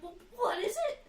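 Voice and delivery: high, loud imitating mom; mock mom question. Mom challenging daughter, high mocking question. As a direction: play the puzzled mom.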